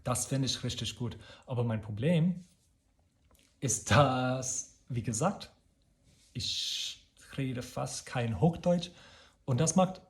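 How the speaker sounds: normal talking voice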